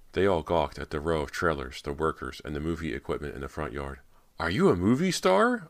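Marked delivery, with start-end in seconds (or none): 4.4-5.7 put-on high-pitched, loud girlish call. A narrator's reading. Girl in passing car to Cody, calling out. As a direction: shout it out like an excited onlooker. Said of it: "a girl in the back called out".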